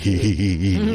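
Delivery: mimicking a friend's helpless giggle; imitated giggle. Friend cracking up at a photo joke, imitated giggle. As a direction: giggle "hee hee" as an impression. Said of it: "wanted to make him laugh".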